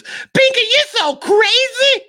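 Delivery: very high, slow squeal; Fatima scolding Binky in a squeal; Miss Fatima voice. Retold or imitated. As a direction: coo it sky-high and teasing. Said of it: "all she ever says is".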